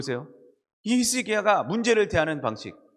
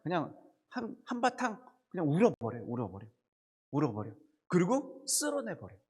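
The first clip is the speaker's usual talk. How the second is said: softly urged; 6 dB quieter than usual. Real, not acted. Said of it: "힘들 때는 그냥 한바탕 울어버려".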